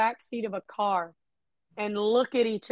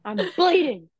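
higher, louder mock yell at grandma; hammed-up warning shout, pushing her back; retold yell; 8 semitones higher, 5 dB louder than usual. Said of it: "put it in her face and I was like".

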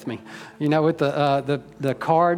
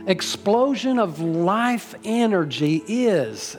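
higher-pitched, building sermon delivery; fervent preaching; 4 semitones higher than usual. Acted. Pastor urging the congregation toward God, fervent preaching. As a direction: lift the pitch and drive the message home.

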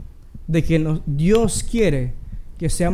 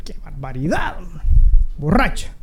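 scolding priest; 3 semitones lower than usual. Acted.

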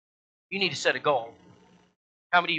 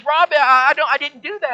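put-on flustered old lady; 9 semitones higher, 7 dB louder than usual. Tony distressed.

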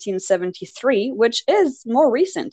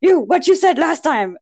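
high, loud mock accusation; accusing; 9 semitones higher, 5 dB louder than usual. Coworker waving a finger in the speaker's face, accusing. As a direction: confront them, voice pitched up.

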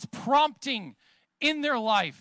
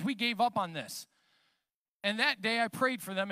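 usual speaking voice

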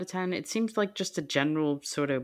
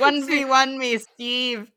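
louder, higher playful trash talk; playful taunt; 8 semitones higher, 6 dB louder, slower than usual. Pretend. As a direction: egg him on. Mock challenge to Steve Huff.

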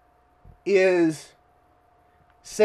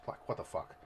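whispered aside; 13 semitones lower, 22 dB quieter, faster than usual. Real, not acted. Aside reacting to rude lady.

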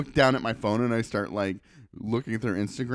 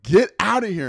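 louder, higher mock yell; acted-out shouted dismissal; retold angry order; 7 semitones higher, 6 dB louder than usual. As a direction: order someone off, imitating a mad worker. Worker ordering him away angrily.

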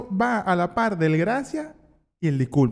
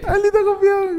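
mock outrage; 13 semitones higher, slower than usual. Mock insult at cohost.

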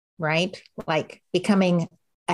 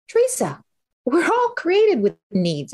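gentle God voice; 10 semitones higher than usual. Pretend. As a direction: speak as a loving God reassuring her. Voicing God's answer.

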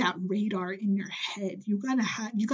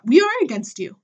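louder and higher, hitting we; raised voice, calling them out; emphatic outburst; 5 semitones higher, 7 dB louder than usual. Real, not acted. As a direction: declare it loud to their face. Ranting at estranged relative.

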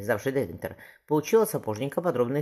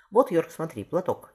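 louder, higher theatrical delivery; stage declamation; 6 semitones higher, 5 dB louder than usual. Acted. Burbage shows young Paul how to play tragedy, declaiming. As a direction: declaim it to the galleries. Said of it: "Совсем не так это надо говорить".

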